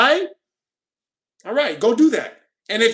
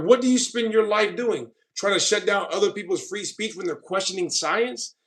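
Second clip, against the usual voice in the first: ordinary talk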